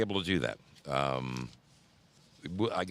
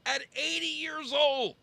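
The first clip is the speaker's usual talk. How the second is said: amazed. Real, not acted. Marveling at eighty-year-old rider.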